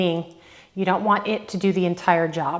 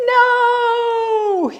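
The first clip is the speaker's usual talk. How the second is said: whiny protesting exerciser; 14 semitones higher, 5 dB louder than usual. Retold or imitated. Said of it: "You're like".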